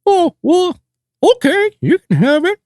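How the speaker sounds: very high, squeaky cartoon voice; a squeaky pushover giving in; high character voice.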